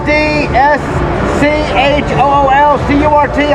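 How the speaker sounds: each letter read out slowly and clearly; spelled out slowly. Shown as a demonstration. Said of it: "spelling channel name out".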